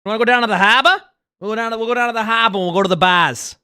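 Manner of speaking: put-on "harbor" as "hahba", "bars" as "bahz" in a thick Boston accent. Streamer playing a Boston bar-hopper, thick Boston accent. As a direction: play a Boston guy inviting his buddy out. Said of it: "Boston accents".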